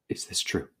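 whispered in shock; hushed disbelief; stunned whisper. Acted. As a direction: barely breathe the question. Romeo hears of Juliet's death.

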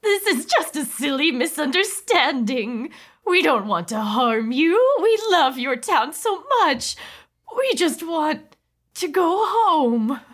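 high, slow plaintive alien; pleading alien wife. Acted. Mrs Person pleading.

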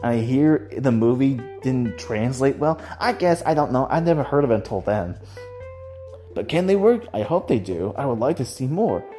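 ordinary delivery

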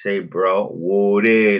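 imitating a drawn-out Mississippi country twang. Imitating brother from Mississippi saying bro, with country twang. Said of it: "But when he says, like".

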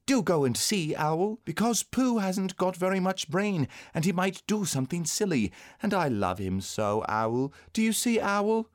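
put-on high, anxious child's voice; worried little boy begging a favour; pleading young boy. A narrator's reading. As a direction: plead earnestly with a friend, high-pitched.

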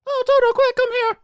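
urgent, very high mock squeal; Dorothy impression. Dorothy calling her dog Toto, mock falsetto squeal. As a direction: call out in loud exaggerated falsetto. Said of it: "That's exactly how she sounds the whole movie".

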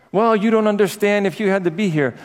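high-pitched mock self-justifying; acting out a defensive spouse; defensive excuse.

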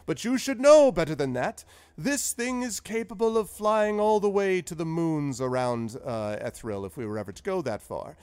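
put-on high, loud and delighted old wizard; jolly old wizard. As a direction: sweep through it like a proud inventor. Zephyros voiced by GM.

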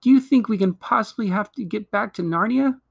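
put-on high-pitched, eager girlish question; excited exclamation. A narrator's reading. Lucy asks Peter about Narnia, excitedly. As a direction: ask with rising wonder. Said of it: "exclaimed Lucy".

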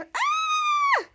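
piercing high scream. Retold or imitated. Piper startled by Schwartz, piercing high scream. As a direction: shriek as if scared out of your wits. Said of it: "Piper turned around and screamed".

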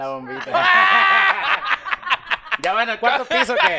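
high laughing words through laughter. Real, not acted. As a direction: laugh while you say it. Laughing at a joke.

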